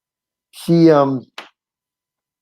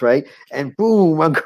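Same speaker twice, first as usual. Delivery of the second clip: ordinary talk, nothing marked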